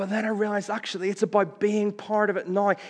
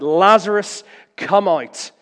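loud commanding call; 3 semitones higher, 8 dB louder, slower than usual. Acted. Jesus calling Lazarus out of the tomb, loud command. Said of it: "Jesus cries out".

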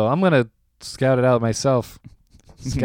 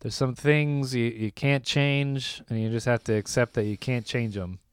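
calm therapist voice; 3 semitones lower, 8 dB quieter than usual. Retold or imitated. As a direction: lay out the advice calmly. Quoting the therapist's advice.

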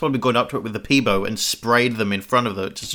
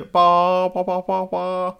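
high, held "ba" pulses; imitated old bus sound; 9 semitones higher, much slower than usual. Recalling old Sydney buses driving past, imitated bus noise. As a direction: honk out repeated bas on one high note. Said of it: "buses that used to go".

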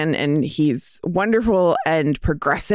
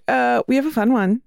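ordinary talk

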